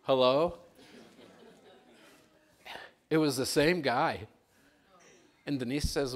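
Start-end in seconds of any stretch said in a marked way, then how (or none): 0.0-4.3 emphatic punchline. Real, not acted. Punchline to congregation.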